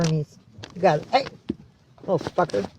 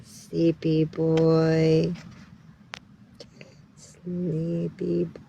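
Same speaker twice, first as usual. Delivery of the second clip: drawn-out drowsy murmur; slow sleepy voice; much slower than usual. Pretend. Owner voicing her cat as sleepy, slow drawn-out words.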